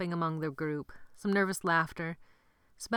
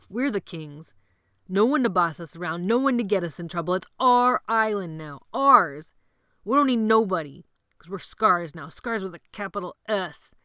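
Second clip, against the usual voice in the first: louder, building, triumphant; rallying speech; 7 dB louder than usual. Acted. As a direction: crown them kings of the island. Sebastian rallies the boys.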